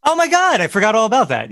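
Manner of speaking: sudden high surprised gasp; as if it just came back. Real, not acted. Suddenly remembers forgotten band.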